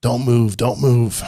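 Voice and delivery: imitating a paramedic yelling a warning; retold scream. Ambulance driver to the downed rider, mild mock yell. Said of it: "me screaming at me saying".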